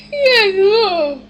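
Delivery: loud, high mock cry; mock wailing. Player lamenting an empty mailbox, mock wailing. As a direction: wail it out in mock despair. Wailing over having no mail.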